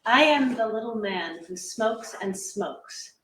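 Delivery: normal talking voice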